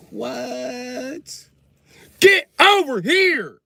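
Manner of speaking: very high, slow, loud angry call; angry parent yell. Acted. Comic parent shouting at America to come back, very loud. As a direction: yell for the kid furiously. Parent angrily calling kid.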